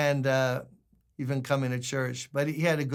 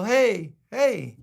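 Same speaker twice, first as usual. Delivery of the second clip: phone hey hey; 6 semitones higher, 4 dB louder than usual. Retold or imitated. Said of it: "On the phone, they go".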